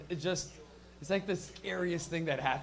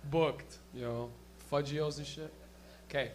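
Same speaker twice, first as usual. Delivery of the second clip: ordinary delivery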